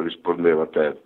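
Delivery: ordinary delivery, nothing marked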